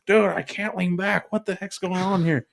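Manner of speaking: high-pitched mock complaint; retold exasperation. Retelling his struggle with a backwards chair, high exasperated voice. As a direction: pitch up a comic gripe.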